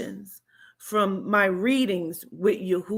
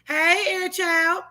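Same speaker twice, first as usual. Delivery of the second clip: mock officer greeting; 9 semitones higher, slower than usual. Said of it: "He said".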